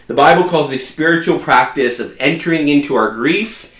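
usual speaking voice

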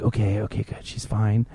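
softly murmured inner relief; relieved murmur. Retold or imitated. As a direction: mutter it under your breath, relieved. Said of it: "and I'm like".

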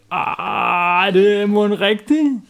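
put-on high, slow, doubting boy voice; doubtful boy. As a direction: drag it out, unsure it's true. Palle doubts, quoted.

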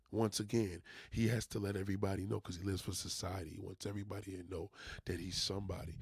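ordinary delivery

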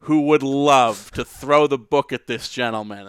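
slow, loud courtroom plea; booming lawyer oratory. Pretend. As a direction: thunder it at the jury slowly.

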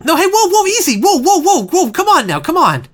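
high, rushed whoas of panic; alarmed protest. Acted. Client panics as his lawyer accepts the deal. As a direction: stop them before they sign, in a panic.

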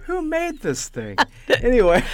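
high giggly voice; laughing through the words. Real, not acted.

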